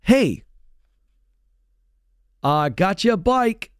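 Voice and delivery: breezy dad. Retold or imitated. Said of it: "a parent coming home one day and saying to their kid".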